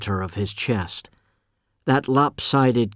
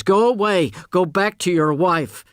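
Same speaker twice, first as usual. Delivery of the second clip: higher, louder angry cry down the stairs; angry shout; 8 semitones higher, 3 dB louder than usual. Acted. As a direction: order him away at full force. Ruth orders him out.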